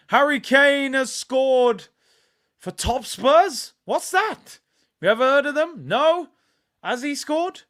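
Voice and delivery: high, slow, loud sarcastic disbelief; mock them as if nobody knows the club. Mocking rival club Tottenham.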